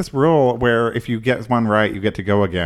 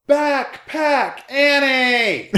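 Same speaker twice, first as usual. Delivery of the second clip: high, drawn-out mock yell; imitated kid yelling; much slower than usual. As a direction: taunt from across the street, stretching each syllable. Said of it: "he started yelling".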